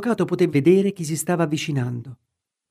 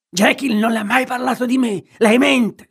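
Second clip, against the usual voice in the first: much higher, louder furious shriek; shrieking in rage; 9 semitones higher, 6 dB louder than usual. A narrator's reading. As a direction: lash out, screeching the accusation. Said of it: "strillò di colpo Hyde in preda alla rabbia". (strillo furioso, molto più acuto e forte)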